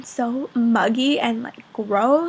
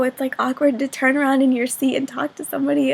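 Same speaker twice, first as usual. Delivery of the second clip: ordinary delivery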